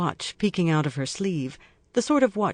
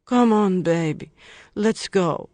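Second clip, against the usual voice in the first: put-on louder slurred Russian accent; 3 semitones higher, 3 dB louder, slower than usual. A narrator's reading. Lucy pretending drunk to lure him, Russian accent. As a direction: fake a drunk Russian girl's slur. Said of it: "she slurs with a Russian accent".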